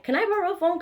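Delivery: high-pitched pleading question; pleading, pitched well up; retold urgent plea. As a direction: re-enact begging for a favor. Retelling urgent plea for a phone.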